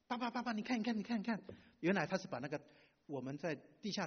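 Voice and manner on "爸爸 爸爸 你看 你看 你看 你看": higher, eager calling to dad; a kid bursting to show off; excited child. Retold or imitated. Daughter shows her drawing. (兴奋地叫爸爸看)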